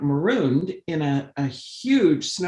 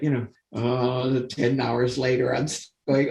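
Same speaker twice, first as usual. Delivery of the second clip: ordinary talk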